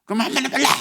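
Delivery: high, loud outburst at mum; defiant child. Acted. Scolded child talking back to mother, defiant yell. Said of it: "엄마도 또 대들잖아요".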